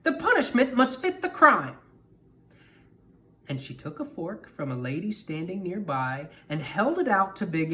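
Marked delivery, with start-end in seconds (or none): 0.0-1.8 stern witch. Acted. Stregonona judges Anthony.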